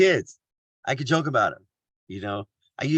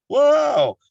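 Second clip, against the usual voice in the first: much higher, wide-eyed gasp of disbelief; amazed audience voice; 11 semitones higher, 6 dB louder, slower than usual. Retold or imitated. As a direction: play the stunned listener asking why. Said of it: "They're like".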